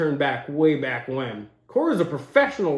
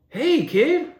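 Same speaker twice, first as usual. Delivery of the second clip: mock umpire; 8 semitones higher than usual. Imagined umpire approaching a kid.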